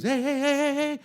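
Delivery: high, loud hey over and over; retold scream. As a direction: yell hey into the radio. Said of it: "she screams".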